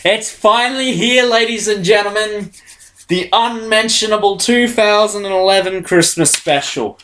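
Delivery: high grand showman intro; announcer voice. Pretend. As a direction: present it like a game show host. Mock announcer intro of episode.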